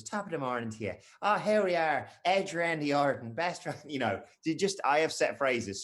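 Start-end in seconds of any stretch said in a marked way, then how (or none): 0.0-3.7 hammed-up Irish lilt, rising louder; Irish accent. Shown as a demonstration. Host demonstrating his Irish warm-up phrase on stream, hammed-up Irish. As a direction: launch into a stage Irish accent. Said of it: "to get into my Irish".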